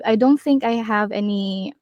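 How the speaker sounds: ordinary talk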